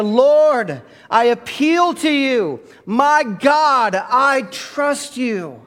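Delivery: high, loud, slow full-force prayer; praying like you mean it. Shown as a demonstration. Pastor urging the church to mean it, praying loud and slow. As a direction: pour everything into the plea. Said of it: "Say it like you mean it".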